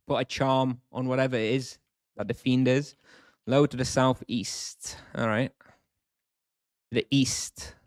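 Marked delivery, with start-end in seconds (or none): none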